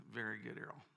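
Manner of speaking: low, soft, dry paternal sarcasm; dry father voice. Retold or imitated. Father to son over the dismantled mower, dry and quiet. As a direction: say it like a quietly unimpressed dad.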